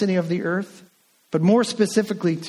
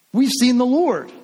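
excited; 8 semitones higher, 5 dB louder than usual. Acted. Disciples excitedly telling Thomas.

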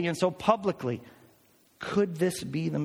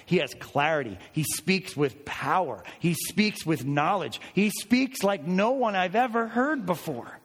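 ordinary talk

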